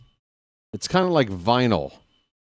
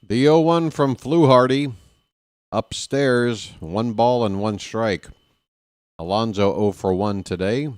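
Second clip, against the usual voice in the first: play-by-play; 3 semitones higher than usual. Acted. Live play-by-play call.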